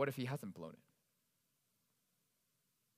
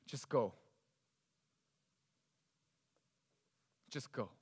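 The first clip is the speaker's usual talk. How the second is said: defeated David; 6 semitones lower, slower than usual. Retold or imitated. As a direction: let the words sink, defeated. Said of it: "he's saying".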